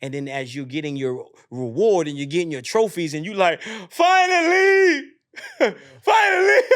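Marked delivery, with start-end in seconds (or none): 3.9-6.6 very high, loud, drawn-out cry with a laugh; elated relief. Acted. Runner gets the trophies at last, screaming elated relief. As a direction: wail it slowly in laughing triumph.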